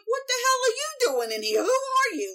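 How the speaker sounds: a startled wife's squeal, played for laughs; high, fast mock panic at an intruder; mock alarmed shriek. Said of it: "she's like".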